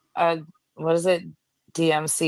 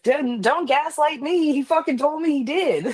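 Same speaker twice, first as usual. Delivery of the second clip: indignant retort; 9 semitones higher than usual. Retold or imitated. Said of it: "I was getting messages like".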